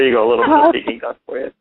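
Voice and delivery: excited. Acted. Duck voice lingering, fast wrap-up.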